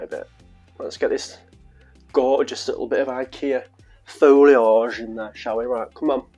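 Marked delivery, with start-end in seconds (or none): none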